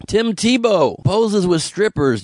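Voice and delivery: slow, drawn-out scandal announcement; mock headline announcer. Comic announcing a football star's scandal, mock news read. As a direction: proclaim it slowly as shocking news.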